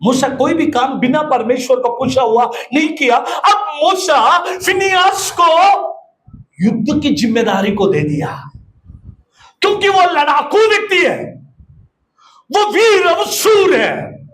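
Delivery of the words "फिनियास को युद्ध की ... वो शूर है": high, shouted praise of a warrior; preaching at full force. Acted. Pastor to congregation, shouting Phinehas' praise. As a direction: proclaim his valour, shouting.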